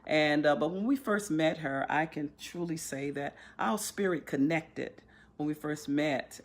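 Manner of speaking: ordinary talk, nothing marked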